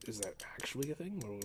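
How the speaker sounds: whisper. Real, not acted. Whispered question.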